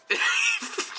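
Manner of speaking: high unvoiced giggle; quick airy laugh at own joke; breathy high giggle. Real, not acted.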